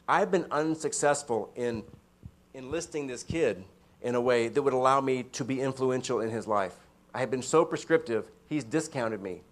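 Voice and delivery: ordinary talk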